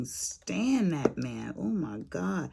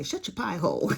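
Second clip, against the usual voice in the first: sister's saying. Retold or imitated. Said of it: "like my sister say".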